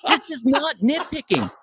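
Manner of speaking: high, insistent and worked up; heated. Real, not acted. Defending his nitpick to his friend, heated and insistent. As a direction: push back hard, getting heated. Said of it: "This boy heated".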